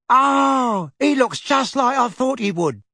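put-on a bright young witch, delighted. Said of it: "said the witch".

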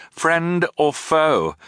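slow, wary question down the hatch; wary call. A narrator's reading. Siblings calling down a dark hatch, slow and wary. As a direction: ask it slowly, unsure who answers. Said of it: "they wanted to say".

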